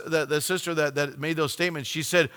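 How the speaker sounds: ordinary talk, nothing marked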